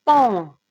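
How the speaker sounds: "pan" said loud and crisp as a separate syllable; syllabe détachée. Shown as a demonstration. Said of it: "Alors, c'est re". ("pan" prononcé fort, syllabe bien détachée)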